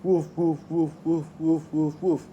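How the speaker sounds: hammed-up barking in a joke; dog woofs. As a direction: bark the woofs out like a joking dog. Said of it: "And the dog says".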